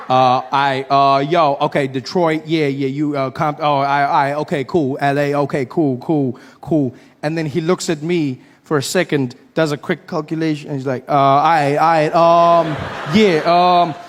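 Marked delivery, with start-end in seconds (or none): 0.1-6.9 louder nervous fumbling for words; caught-out comic scrambling; flustered stammering. Retold or imitated. Flustered comedian in story. 11.1-13.9 a comic fumbling out loud; loud stalling. Retold or imitated. Comedian stalls in story.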